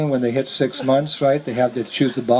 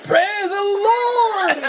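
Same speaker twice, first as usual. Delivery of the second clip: drawn-out praise; much slower than usual. Retold or imitated. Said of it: "he went".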